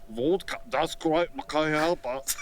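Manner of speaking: put-on, hammed-up Russian accent; thick mock Russian. Shown as a demonstration. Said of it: "Try it in a Russian accent".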